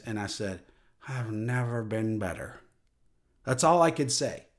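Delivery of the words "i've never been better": a soft, dazed, drugged murmur; dazed reply. Retold or imitated. Dazed man answering a worried parent at the meeting. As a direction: say it through a fog of laughing gas. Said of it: "a dazed look on my face".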